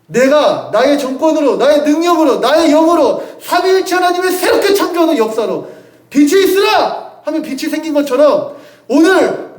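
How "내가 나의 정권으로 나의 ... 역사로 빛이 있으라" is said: divine proclamation. Retold or imitated. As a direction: proclaim it like God speaking light into being. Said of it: "하나님이 선언하시는 거라고요".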